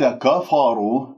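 "كفروا" with thick, dark ka and fa; wrongly heavy kaf, fa. Shown as a demonstration. Teacher shows a mistake caused by the ra, over-heavy كفروا. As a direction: darken both a vowels in "كفروا". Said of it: "فخمت الكاف والفاء بسبب الراء، وهذا خطأ".

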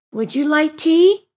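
plain reading voice

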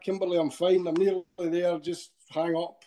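ordinary talk, nothing marked

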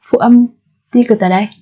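ordinary delivery

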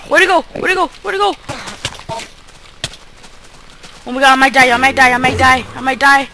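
high, loud frantic repetition; frantic panic. Real, not acted. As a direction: blurt it in rising panic. Under attack, fearing death.

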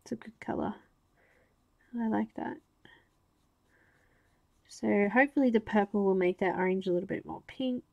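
ordinary talk

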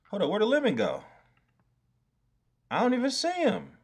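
sudden high alarmed voice; panicking, voice jumping up; alarmed squeak. Real, not acted. Streamer can't find his lemon drawing, high alarmed squeak.

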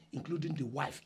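ordinary talk, nothing marked

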